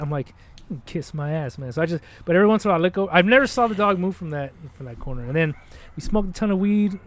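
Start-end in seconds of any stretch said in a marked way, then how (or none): 0.3-1.7 low-volume, slow grumbled comeback; quietly defiant, retold; sullen retort. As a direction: say it slowly under your breath. Retold upset retort kept low.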